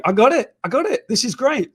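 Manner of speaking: sudden bright idea, bursting to go; thrilled he has found a fan; excited. Retold or imitated. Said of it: "and I'm like".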